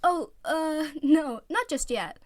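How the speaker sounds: stall, caught out; nervous dodge. Acted. Oikawa dodging his teammates' question, nervously stalling. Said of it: "eyes shifted around the room nervously".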